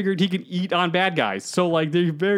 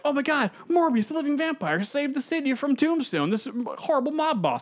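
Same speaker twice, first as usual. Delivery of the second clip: gushing news report; 9 semitones higher than usual. Retold or imitated. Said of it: "And so, like".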